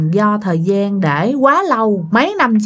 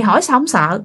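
usual speaking voice

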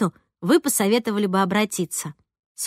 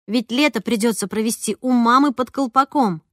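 plain reading voice

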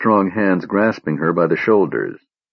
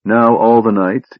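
ordinary delivery, nothing marked

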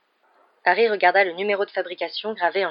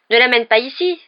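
protestation; 5 semitones higher, 8 dB louder than usual. Acted. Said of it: "protesta Ron".

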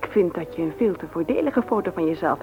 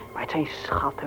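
whisper; 3 semitones lower, 6 dB quieter than usual. Acted. Fond aside about her kids.

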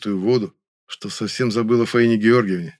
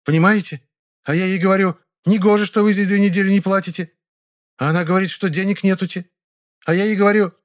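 high, louder, agitated chatter; angry cry; 10 semitones higher, 4 dB louder than usual. A narrator's reading. Woman retells her quarrel over unpaid weeks, indignant. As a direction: pour out the grievance indignantly. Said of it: "сердито вскричала женщина".

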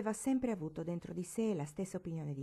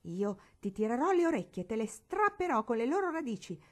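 louder, rising to a higher furious threat; devilish threat; 4 semitones higher, 5 dB louder than usual. Acted. Barbara threatening to tear Marigold's ears, raging louder. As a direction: threaten her like a little demon. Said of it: "ribadì Barbara indiavolata".

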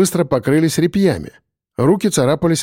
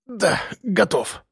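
soft, slow, higher-pitched assent; apprehensive; 5 semitones higher, 6 dB quieter, slower than usual. A narrator's reading. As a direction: brace yourself for the pain. Braced for the nail pull. (тихо, с опаской)

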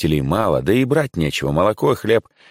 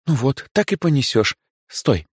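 kindly shop manager, higher; friendly older woman voice; директриса, добрая; 7 semitones higher than usual. A narrator's reading. Director voice. (добродушная директриса)

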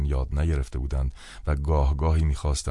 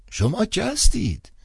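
put-on high, slow suspicious old man; startled old priest on the phone; 3 dB louder than usual. A narrator's reading. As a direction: answer like a wary old cleric.

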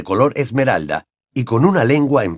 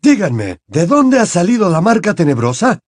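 mildly urgent, rising in pitch; hurried question; 8 semitones higher, 4 dB louder than usual. A narrator's reading. Father asking the children where the mark came from, hurried. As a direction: press them for a quick answer. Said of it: "preguntó apresuradamente el señor Weasley".

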